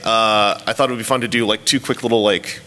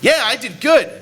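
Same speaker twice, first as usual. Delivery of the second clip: high-pitched triumphant self-congratulation; acted-out thrill at being appreciated; pitched-up, proud exclamation; feeling good, validated; 10 semitones higher than usual. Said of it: "made you feel like".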